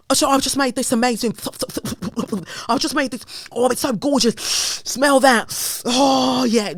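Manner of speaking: high, loud, gushing chef voice; mock celebrity chef. Mocking Jamie Oliver to viewers, high gushing chef. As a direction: ham up a chef showing off his dish.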